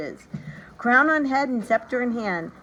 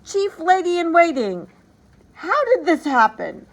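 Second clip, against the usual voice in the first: loud, much higher furious shout; furious royal outburst; enraged king; 9 semitones higher, 8 dB louder than usual. A narrator's reading. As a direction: yell for the lady in waiting in a rage. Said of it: "cried aloud".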